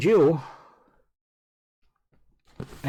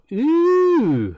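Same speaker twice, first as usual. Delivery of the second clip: excited ooh; 9 semitones higher, 5 dB louder than usual. Real, not acted. Hoping to pick up Amiga.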